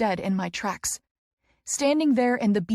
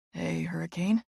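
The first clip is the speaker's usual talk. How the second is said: 1 smiling greeting; 3 semitones lower, 4 dB quieter than usual. A narrator's reading. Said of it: "he says with a smile".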